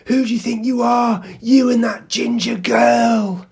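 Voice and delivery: high, loud, slow taunting yell; angry shout. Retold or imitated. Stranger on a bike yelling threats across the park, loud slow taunt. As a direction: shout it like you're picking a fight. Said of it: "Like that".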